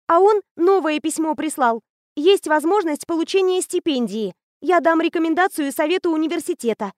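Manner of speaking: put-on high, bright-eyed girl raving about a machine; eager teenage girl. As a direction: gush like a know-it-all teen. Anya voiced high.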